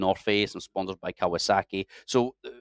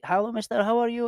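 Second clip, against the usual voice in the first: imitated high, eager hello; high sing-out street greeting, imitated; mock friendly greeting; 13 semitones higher than usual. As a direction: greet a stranger brightly, as a retold local. Acting out friendly locals' greeting.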